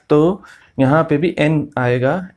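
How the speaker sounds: ordinary delivery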